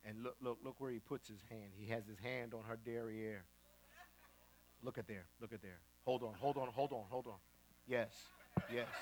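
low, very soft imitation of TV investigator; hushed surveillance commentary. Investigator shows client her cheating partner, hushed. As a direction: murmur it like a stakeout report.

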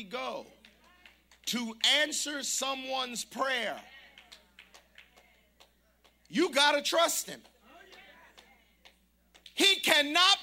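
full-throated pulpit preaching; preaching at full force. Real, not acted. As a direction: drive each phrase home to the congregation. Preaching build to climax.

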